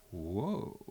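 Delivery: low, soft rumbling whoa; castle's whoa. Acted. Teller voices the waking castle, low soft whoa. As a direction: groan like an old building.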